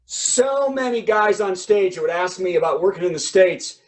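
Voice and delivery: normal talking voice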